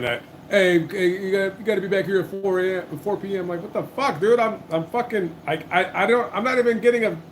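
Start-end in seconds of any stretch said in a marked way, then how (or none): none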